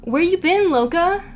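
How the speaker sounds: put-on, playful questioning of someone who vanished; playful mock demand. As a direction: call it out high, in mock reproach. Voices people wondering where you are.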